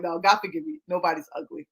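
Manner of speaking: usual speaking voice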